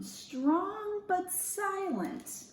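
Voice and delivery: slow, drawn-out thoughtful repeat; mulling over the idea; musing. Acted. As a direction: savor each word slowly.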